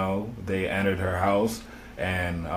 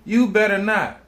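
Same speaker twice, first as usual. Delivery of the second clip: much higher and louder, a barked threat; officer's warning yelled; 11 semitones higher, 7 dB louder than usual. Retold or imitated. The officer threatening Sonya over the pot, loud barked warning. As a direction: hurl the warning at her. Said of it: "And he said".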